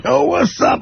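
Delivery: put-on high, rowdy yo like a drunk; drunk rowdy greeting. Comic on the base, hailing soldiers as a drunk. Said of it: "I'm a drunk dick".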